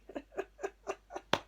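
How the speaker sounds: high breathless giggle; soft unvoiced giggle; real giggle. Real, not acted. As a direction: laugh helplessly at the mishap. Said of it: "Sorry, I'm laughing".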